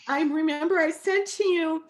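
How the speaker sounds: ordinary talk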